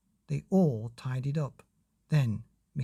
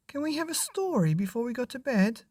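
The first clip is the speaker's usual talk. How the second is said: put-on high, fast pleading child; high child begging dad; 11 semitones higher, faster than usual. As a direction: beg like a little boy. Child asks for story.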